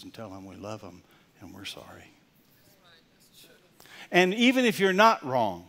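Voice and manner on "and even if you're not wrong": higher and louder, full force; preaching shout. Real, not acted. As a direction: drive it home to the congregation. Preaching climax.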